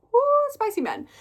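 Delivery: giddy high woo; gleeful cheer. Real, not acted. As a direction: cheer like a fan. Gleeful cheer about spicy men.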